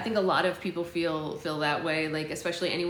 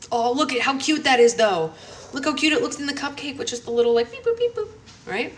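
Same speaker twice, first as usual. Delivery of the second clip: excited cooing; 8 semitones higher, 8 dB louder than usual. Real, not acted. Gushing over cute slime decoration.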